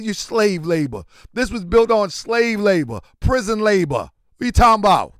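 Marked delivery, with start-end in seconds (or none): none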